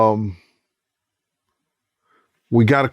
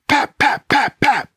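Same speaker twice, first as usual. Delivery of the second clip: high, popping mock gunfire; gunshot imitation; 11 semitones higher, slower than usual. Retelling the shooting, imitating the gunshots. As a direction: spit out the pops like shots going off. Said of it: "five or six clubs was like".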